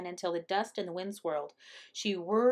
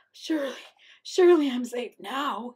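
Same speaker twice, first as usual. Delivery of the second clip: higher, worn-out relief; exhausted Coyote; 4 semitones higher, 4 dB louder than usual. A narrator's reading. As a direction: voice Coyote collapsed and hoping for safety. Exhausted Coyote hopes he's safe.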